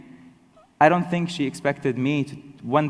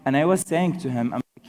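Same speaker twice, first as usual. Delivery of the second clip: ordinary talk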